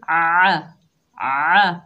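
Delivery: आ pushed loud from the middle of the throat; ain from the throat. Shown as a demonstration. Teacher shows students the ain sound from the throat, loud. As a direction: voice आ firmly from mid throat. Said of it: "हलक के दरमियान से अदा करेंगे".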